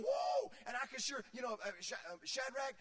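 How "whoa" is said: high yelp of alarm; a sudden loud whoa of surprise; startled whoa. Retold or imitated. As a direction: jump at it, shocked. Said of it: "I'm sure they're going".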